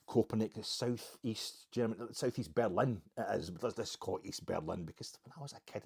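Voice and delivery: ordinary talk, nothing marked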